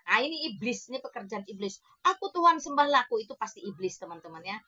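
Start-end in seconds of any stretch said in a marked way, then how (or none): 2.0-3.2 higher and louder, a hammed-up demand for worship; mock devil boast. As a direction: play the false god shouting to be worshipped. (iblis palsu berteriak minta disembah, dibuat-buat)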